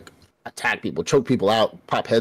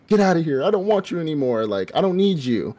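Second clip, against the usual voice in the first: higher, forceful rejection; pushing her away; 7 semitones higher than usual. Retold or imitated. Demon sending away the woman he loves, forceful and raised.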